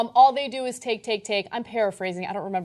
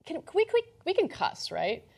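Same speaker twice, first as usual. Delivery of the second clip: high and hesitant, asking permission; a squeaky, halting check before swearing; sudden outburst; 7 semitones higher, 5 dB quieter than usual. Retold or imitated. To the host, asking if swearing is allowed, high and hesitant. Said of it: "I turned around and I was like".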